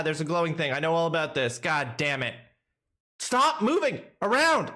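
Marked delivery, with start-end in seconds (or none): 3.2-4.7 high loud snapping order; frustrated yell. Real, not acted. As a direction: bark at the character. Yelling at astronaut.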